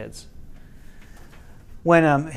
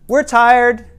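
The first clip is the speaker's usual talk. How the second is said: shouted reply; 9 semitones higher, 9 dB louder than usual. Retold or imitated. Teens shout back downstairs.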